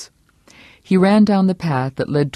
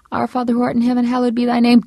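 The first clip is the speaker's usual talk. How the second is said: higher voice, fervent prayer; praying wife; 9 semitones higher, much faster than usual. A narrator's reading. Vera praying aloud to drown out Herb. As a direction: pray over him to shut him out.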